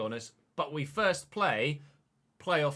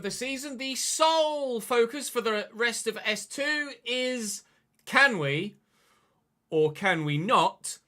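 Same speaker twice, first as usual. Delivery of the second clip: presenter voice; 7 semitones higher, 5 dB louder than usual. Acted. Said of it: "presenter segment intro".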